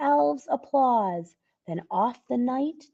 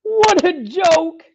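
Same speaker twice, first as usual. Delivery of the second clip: slow, high, louder chuckling line; chortling; 8 semitones higher, 10 dB louder, slower than usual. Acted. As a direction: chortle through the words. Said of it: "he chortled".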